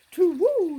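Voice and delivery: high, loud owl call. Acted. The owl answering the child, hooting loudly.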